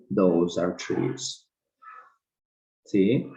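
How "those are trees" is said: very slow, careful Those are trees; very slow model sentence. Shown as a demonstration. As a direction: stretch every word of Those are trees for the class. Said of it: "Aquellos o esos son lápices".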